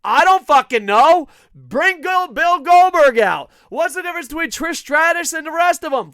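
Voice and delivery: loud, high, exasperated shouting; real yelling rant. Real, not acted. Podcaster ranting about bringing back wrestling legends, yelling. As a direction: yell it out at full volume. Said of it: "Why the fuck are you yelling?".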